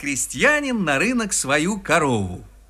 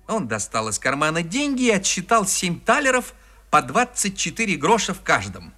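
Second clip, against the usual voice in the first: ordinary delivery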